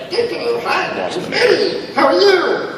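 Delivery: very high-pitched bubbly cartoon voice; bubble voice. Shown as a demonstration. Actor answers the director's request, high bubble voice. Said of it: "Use your bubble voice".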